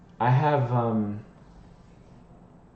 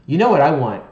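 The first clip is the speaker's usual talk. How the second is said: loud eager question; excited emphasis; 4 semitones higher, 10 dB louder, faster than usual. Real, not acted. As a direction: burst out with it. Excited question, then repeated.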